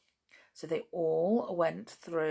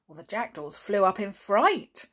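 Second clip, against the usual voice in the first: usual speaking voice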